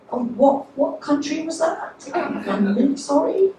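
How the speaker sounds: timid apology. Retold or imitated. British passenger apologises to cab driver, acted high and timid. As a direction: shrink back and apologise meekly.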